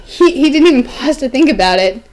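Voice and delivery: ordinary delivery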